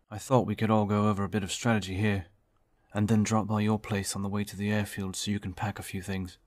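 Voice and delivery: a steady officer taking charge, projecting to the room; David, firm team leader. Acted. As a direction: lay out the plan like a confident commander.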